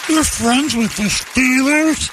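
high, slow, starstruck squeal; gushing housewife. Acted. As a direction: swoon over the Steelers name.

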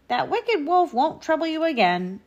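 high character voice; reassuring hunter. A narrator's reading. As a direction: promise safety like a storybook rescuer. Hunter reassures the rescued pair.

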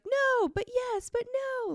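put-on high, slow whiny toddler. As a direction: drag it out like a torn little kid. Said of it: "him being like".

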